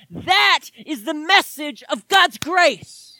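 high, loud testimony building to a shout; impassioned preaching. Real, not acted. Preacher testifying he was a slave to sin, impassioned.

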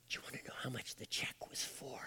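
quick, barely audible whisper; suspenseful whisper. Retold or imitated. Grandpa teasing the grandkids with the amount, suspenseful whisper. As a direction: whisper it like a secret.

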